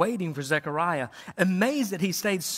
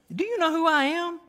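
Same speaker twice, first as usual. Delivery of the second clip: put-on higher, accented angel repeating his challenge; Gabriel's accented voice, more worked up; 10 semitones higher than usual. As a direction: push the accent further on the angel's question. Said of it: "a little, little accent added there".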